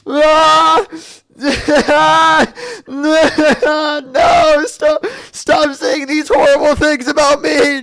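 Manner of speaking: mock sobbing to show off crying on cue; fake crying. Streamer hams up crying on command, hammed-up wailing. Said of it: "I've developed the ability to cry on command".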